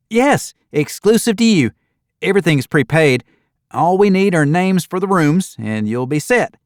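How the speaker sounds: put-on cheerful young desk clerk greeting guests. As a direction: chirp it out like a perky receptionist.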